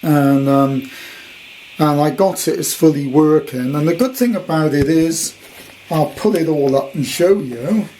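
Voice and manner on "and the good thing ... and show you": usual speaking voice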